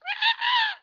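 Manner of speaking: high, loud excited shriek; a burst of thrilled shrieking; fangirl squeal. Real, not acted. Fan squealing as her idol signs her shirt, high and loud.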